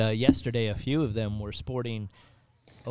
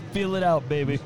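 usual speaking voice

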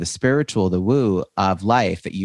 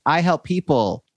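higher, chirpy generic pitch; mock naive newbie; 7 semitones higher than usual. Host mocking the common beginner mistake, chirpy and higher. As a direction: parody a vague beginner's pitch. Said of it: "which is like".

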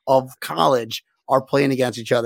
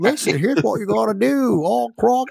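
higher-pitched put-on Australian accent; 5 semitones higher than usual. Player telling the coach what to do, mock Australian accent. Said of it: "not Australian".

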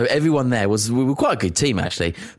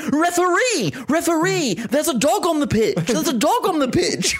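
very high, frantic plummy cry; posh panicked teammate. Retold or imitated. Posh teammate calling the referee about a dog, high plummy squeal. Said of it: "Like this, really well spoken".

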